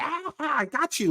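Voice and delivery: a sly witch springing her trap; movie witch. Retold or imitated. Witch revealing herself to the king, high gloating cackle-voice. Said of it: "like, a witch".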